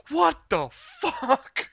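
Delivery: very high, drawn-out mock disbelief; flipping out. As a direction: squeal it slowly in hammed-up outrage. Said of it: "I start flipping out".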